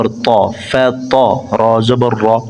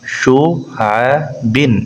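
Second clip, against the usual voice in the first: slow drawn-out demo; much slower than usual. Shown as a demonstration. Teacher to students, very slow drawn-out model reading. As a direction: stretch শুআবিন out very slowly, each syllable held. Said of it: "teacher models word slowly".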